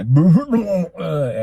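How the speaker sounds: high comic heave, dropping off; imitated retching noise. Host describing nights after overeating, imitated heave. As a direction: act out food coming back up.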